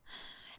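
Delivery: soft, heavy sigh out; weary sigh. Acted. Princess to a stranger, weary sigh. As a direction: let the breath fall out tired. Tired of her life.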